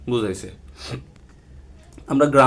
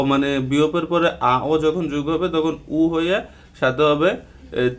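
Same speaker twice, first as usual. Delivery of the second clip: normal talking voice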